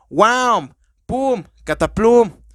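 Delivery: punchy cartoon fight noises; comic sound effects. Shown as a demonstration. Showing listeners comic onomatopoeias, punchy mock fight sounds. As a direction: pop each one out like a comic-book hit. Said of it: "O como".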